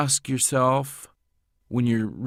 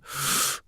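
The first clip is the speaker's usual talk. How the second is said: sharp unvoiced intake of breath; sucking breath; 4 dB quieter than usual. Shown as a demonstration. Voice actor demos a breath noise he edits out, sharp gasp. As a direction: suck in air quickly through the mouth. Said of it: "sucking breath that I have to edit out".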